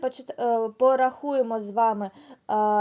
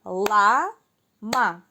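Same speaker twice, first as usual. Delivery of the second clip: clapped syllables; 3 dB louder than usual. Shown as a demonstration. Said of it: "Плескаємо в долоні".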